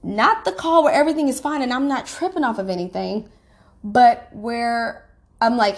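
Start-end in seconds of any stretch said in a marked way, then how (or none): none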